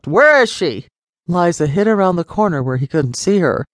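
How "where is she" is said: loud angry demand, pitched high; father shouting for his daughter. A narrator's reading. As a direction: shout it at the cook. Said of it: "He shouted at Cook".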